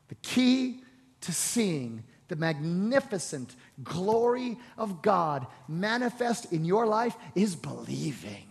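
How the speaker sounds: ordinary talk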